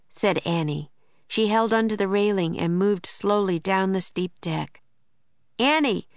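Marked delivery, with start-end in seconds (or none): 5.6-6.1 high falling call; raised, urgent name call; Jack crying out. A narrator's reading. Jack calling Annie down the deck, urgent cry. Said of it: "cried Jack".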